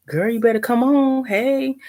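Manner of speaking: put-on high, loud, sassy girlfriend voice; sassy mock voice. Mock voice quoting a sassy reply.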